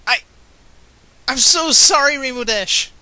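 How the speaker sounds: high, loud crying apology. Acted. Scootaloo sobbing an apology to Rainbow Dash, crying. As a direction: wail the apology through tears. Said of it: "I cry".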